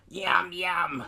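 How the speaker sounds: lightly voiced creature munching; a hint of a greedy little monster; gremlin munching. Gremlin eats the apple.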